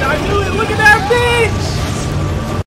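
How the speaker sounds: very high, loud and fast gloating; triumphant yelling. Real, not acted. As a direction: scream it like your prediction came true. Dragon burning the city.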